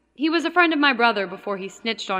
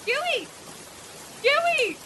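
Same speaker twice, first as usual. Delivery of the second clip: high-pitched cry, far above usual pitch; frightened scream for help; 5 dB quieter than usual. Acted. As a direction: scream the name in rising panic. Cries for help after shocking find.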